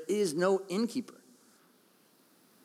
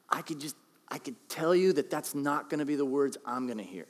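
ordinary talk, nothing marked

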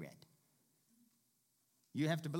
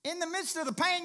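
higher and louder, pulpit power; full-force preaching; 14 semitones higher, 7 dB louder than usual. Acted. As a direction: build to the climax.

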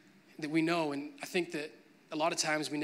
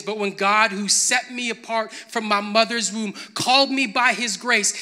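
louder, higher, the verse proclaimed; preaching it out; 5 semitones higher, 10 dB louder than usual. Acted. Reread in preaching voice.

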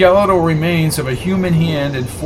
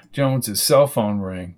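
usual speaking voice

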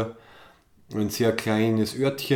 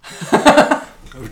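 loud laugh; 8 semitones higher, 12 dB louder than usual. Real, not acted. Laughs at the rudeness remark.

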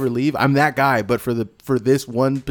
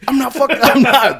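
high mock shout; 12 semitones higher, 4 dB louder than usual. Continues DiCaprio shout.

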